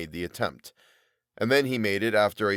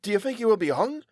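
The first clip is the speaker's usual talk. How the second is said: eager, higher question; hopeful lift in the voice; animated; 12 semitones higher than usual. Acted. Tobias hoping Todd is hanged, eager. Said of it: "with animation".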